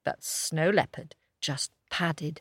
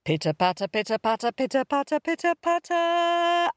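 each one higher; rising sing-song; 10 semitones higher, faster than usual. A narrator's reading. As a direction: climb up in pitch. Rising footsteps sound.

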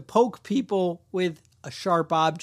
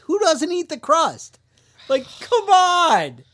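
high, loud, slow disbelief, playing it up; can't believe anyone skips the crust; mock outrage; 10 semitones higher, 6 dB louder, slower than usual. Outrage over uneaten pizza crust.

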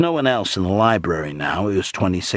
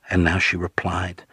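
plain reading voice

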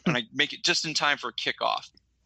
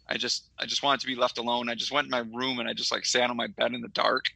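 normal talking voice

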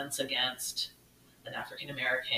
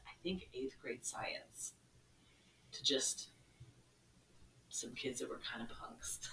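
hushed whisper, much softer; whispered aside; 3 semitones lower, 13 dB quieter than usual. Real, not acted. Telling the host about her friend, in a whisper. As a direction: whisper it like a secret.

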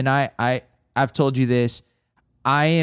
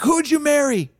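higher, direct probing voice of the mentor; blunt mentor question; 14 semitones higher than usual. Retold or imitated. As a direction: fire the question at him point-blank. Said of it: "kinda New York ways".

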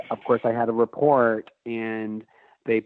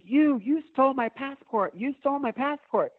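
a frantic, high-pitched yell at the thief; screaming. Retold or imitated. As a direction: shriek it so the whole station turns. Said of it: "'cause I'm screaming".

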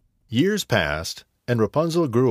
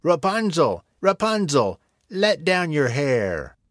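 witch calling out; 6 semitones higher than usual. Acted. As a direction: call up sharply as the witch. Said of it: "and called".

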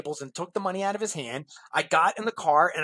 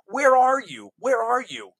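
higher, insistent mock demand; impatient pestering; 3 semitones higher, 6 dB louder than usual. Buyer nagging the seller by email, impatient pestering. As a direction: imitate an impatient buyer, pressing each time.

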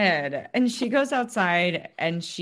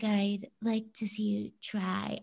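whispered, softer mocking dare; whispered taunt; 3 semitones higher, 6 dB quieter than usual. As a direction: hiss the challenge back quietly. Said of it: "And then S was like".

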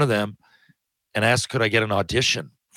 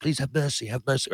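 soft pleading; 3 semitones higher, 7 dB quieter than usual. Shown as a demonstration. Actor demoing his one line, soft desperate plea. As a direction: beg quietly like a beaten soldier. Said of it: "Now, I could do that".

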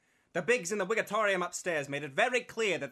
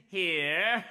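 usual speaking voice

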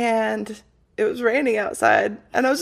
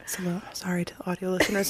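whisper; 4 semitones lower, 11 dB quieter, faster than usual. Real, not acted. Apology muttered near the mic.

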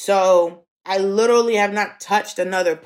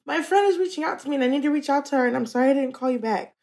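upset rushing apology; 7 semitones higher, faster than usual. Real, not acted.

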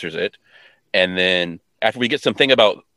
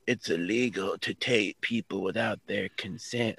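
failed villain voice; 12 dB quieter, slower than usual. Retold or imitated. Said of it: "I can't do the voice".

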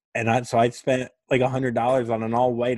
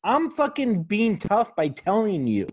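mock defensive; 9 semitones higher than usual. Voicing a defensive self-deprecator.

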